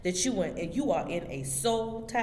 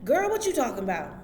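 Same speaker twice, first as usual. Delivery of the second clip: high, fast mock disbelief; put-on high-voiced retort; mimicked incredulous reply; 6 semitones higher, 3 dB louder, faster than usual. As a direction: imitate someone baffled, quick and pitched up. Mimics their puzzled response.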